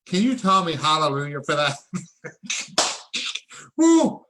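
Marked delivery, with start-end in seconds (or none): 1.8-3.7 quick high laugh of joy; excited breathy laugh. Real, not acted. Host laughing with joy at viewers. 3.8-4.2 high, loud whoop of joy; excited whoop. Real, not acted. Host whooping at the crypto gains, real excitement. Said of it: "I'm excited".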